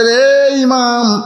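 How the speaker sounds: high, long drawn-out call; madman calling out. Retold or imitated. Madman calling to the imam as he runs, imitated call. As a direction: imitate a madman hailing someone on the run. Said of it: "এবার পাগল দৌড় মারি আর বলে".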